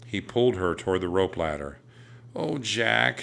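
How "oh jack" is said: put-on plaintive girl, pitched higher; understated sad lament. A narrator's reading. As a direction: say it with sorrow. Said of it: "she said sadly".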